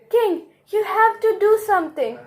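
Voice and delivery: worried urging. Acted. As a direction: press the king urgently, pitch up high. Worried ministers urge the king.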